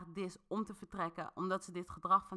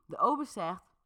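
plain reading voice

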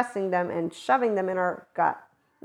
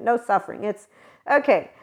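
normal talking voice

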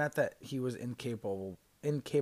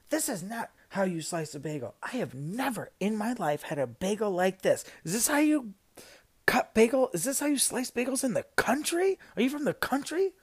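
much higher, louder outraged complaint; angry customer; 9 semitones higher, 5 dB louder than usual. Retold or imitated. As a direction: scold the barista like an indignant New Yorker. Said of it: "she goes".

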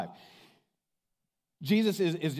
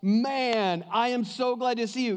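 glad welcome; 7 semitones higher, 4 dB louder than usual. Retold or imitated. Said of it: "he's like".

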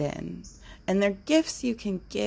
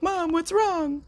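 much higher voice of a concerned pet; dog's worried voice; 13 semitones higher, 3 dB louder than usual. Pretend. Voicing her dog asking mom what's wrong, high and worried. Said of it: "my dog just made a sad cry".

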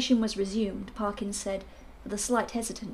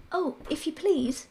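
higher, slower maidservant voice; a deferential maid, pitched up; maid's voice; 7 semitones higher, slower than usual. Acted.

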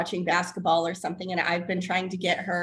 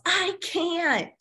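mock toddler yell; 9 semitones higher than usual. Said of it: "she yells".

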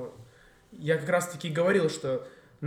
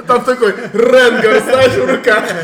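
loud, slow, high laughing words; 8 semitones higher, 18 dB louder, slower than usual. Real, not acted. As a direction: talk while laughing hard. Joking about huge hand.

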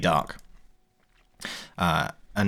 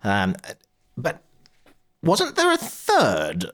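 Gryla's sly jab; 8 semitones higher than usual. Acted. Gryla taunts the players about their dorms, high mocking voice. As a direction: needle the players with mock sweetness. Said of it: "looks at you both and goes".

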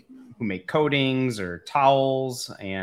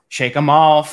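loud and higher, pumping up; 5 semitones higher, 12 dB louder, slower than usual. Real, not acted. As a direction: belt it out to shake nerves. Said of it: "shake 'em off".

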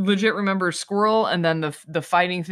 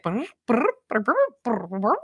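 trilled brr chattering noises; rolling lip trill like a squirrel; 3 semitones higher than usual. Retold or imitated. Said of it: "the squirrels were just like".

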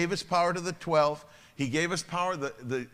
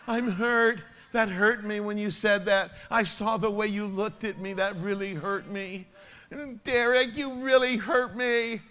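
whiny hurt complainer; 6 semitones higher, 4 dB louder than usual. Acted. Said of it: "you little whiner".

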